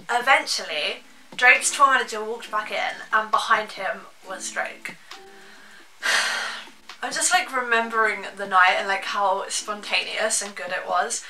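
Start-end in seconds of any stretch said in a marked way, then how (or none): 6.0-6.6 long breathy sigh; audible exhale while reminiscing. Real, not acted. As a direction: let out a wordless sigh. Pauses to remember the night.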